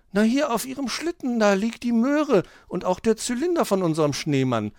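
put-on high, insistent child voice; kitten. Cat shows magpie the loot on sledge, high child voice. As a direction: point it out as an eager young cat.

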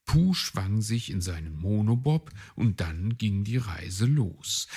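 ordinary delivery

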